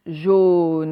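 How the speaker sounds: "jaune" stretched and loud, long closed o, final e voiced; sound-spotting demo. Shown as a demonstration. Teacher showing pupils the o sound, stretched loud demo. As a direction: draw out "jaune" so the o stands out. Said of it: "on entend le son O".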